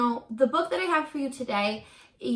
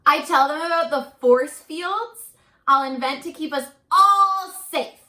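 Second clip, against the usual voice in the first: much higher and louder, lively storybook voice; animated read-aloud; 7 semitones higher, 8 dB louder than usual. A narrator's reading. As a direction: sell the child's big plans to the kids. Teacher reads picture book aloud.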